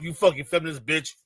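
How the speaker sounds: ordinary talk, nothing marked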